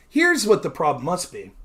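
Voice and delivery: normal talking voice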